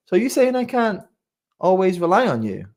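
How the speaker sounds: imitating questioning son. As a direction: lightly imitate a son checking with his parent. Retells young son's doubting question.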